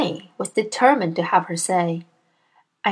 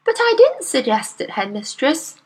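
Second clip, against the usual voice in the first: put-on higher, earnest young teacher voice; Miss Honey; 6 semitones higher, 3 dB louder than usual. Young teacher protests to her headmistress, earnest and higher. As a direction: politely protest to a bully.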